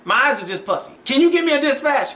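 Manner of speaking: retold yell. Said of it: "yell past her to Danny".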